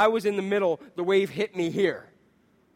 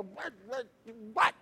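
grumpy nasty wake-up; 7 dB quieter than usual. Retold or imitated. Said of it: "I'm angry, I'm frustrated, I'm nasty".

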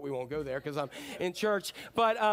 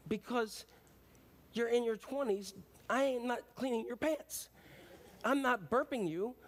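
exasperated scolding; 6 semitones higher than usual. Pretend. Scolding adult acting like baby.

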